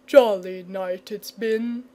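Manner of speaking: slow sleepy murmur; drowsy dragon murmur. A narrator's reading. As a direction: murmur it, half asleep. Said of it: "he murmured".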